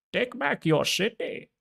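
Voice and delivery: high, slow villain impression; Bane. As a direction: stretch it out in a high, mock-villain voice. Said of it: "I love Bane impressions".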